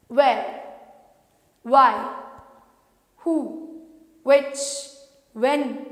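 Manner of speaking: where, why, who, which, when with lips like O; rounded W words. Shown as a demonstration. Teacher running through the W words the same way. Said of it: "Same manner".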